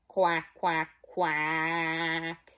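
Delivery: low, mock duck quack. Shown as a demonstration. Showing children how a duck quacks, low. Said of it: "Can you try that? Ready?".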